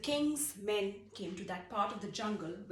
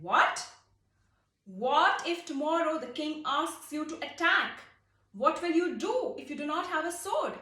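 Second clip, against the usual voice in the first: louder, higher pressing questions; alarmed king; 7 semitones higher, 7 dB louder than usual. Acted. King confronting the soldier about his wooden sword, alarmed. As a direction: corner him with the question.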